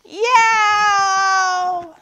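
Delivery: high, loud, long yowl; drawn-out tantrum scream. A narrator's reading. All the animals scream together, screaming. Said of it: "they all screamed".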